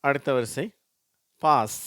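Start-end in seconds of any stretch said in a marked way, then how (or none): 1.4-1.8 பாஸ் with a long open "aa"; teacher's emphatic பாஸ், vowel "aa"; long "aa" pronunciation. Shown as a demonstration. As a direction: model பாஸ் loudly as "paas". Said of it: "அதுவும் அதே ஆ என்ற எழுத்து தான்".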